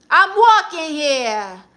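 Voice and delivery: loud, high, drawn-out New York yell; shouted catchphrase. Acted. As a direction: holler it slowly like a Brooklyn street catchphrase.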